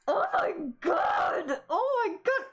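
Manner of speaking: high, drawn-out shrieking; real fangirl scream; excited shriek. Real, not acted. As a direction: scream it out in disbelief. Screaming at game scene.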